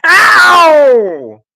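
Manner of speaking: high, loud pained yell; a father's high, loud scream of pain. Acted. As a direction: scream it as the hat is yanked. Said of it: "he screamed".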